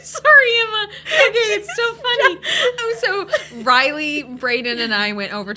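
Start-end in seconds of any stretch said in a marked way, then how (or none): 0.0-2.4 high, loud words bursting through laughter; helpless laughing. Real, not acted. Apologizing to Emma, laughing uncontrollably. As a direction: squeal it out while cracking up.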